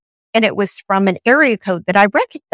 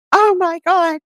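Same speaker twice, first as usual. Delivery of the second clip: retold bawling niece; 10 semitones higher than usual. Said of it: "My niece starts bawling".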